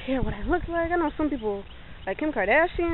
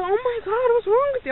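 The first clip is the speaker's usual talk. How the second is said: high, loud alarmed mother; alarmed mom; 13 semitones higher, 4 dB louder than usual. Retold or imitated. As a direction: gasp it out like a shocked mom. Mom alarmed finding her slumped.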